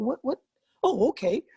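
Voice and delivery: caught by surprise. Retold or imitated. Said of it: "And I go".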